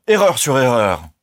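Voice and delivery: loud outraged outburst; exclaimed. A narrator's reading. Colonel exploding at the young man, loud outrage. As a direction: cry it out in scorn. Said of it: "s'écria-t-il".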